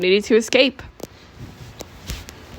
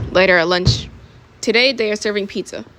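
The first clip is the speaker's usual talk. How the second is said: usual speaking voice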